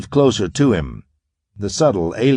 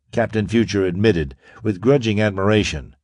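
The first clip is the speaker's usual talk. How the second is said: ordinary delivery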